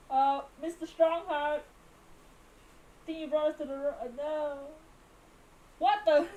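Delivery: normal talking voice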